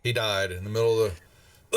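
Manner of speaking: ordinary delivery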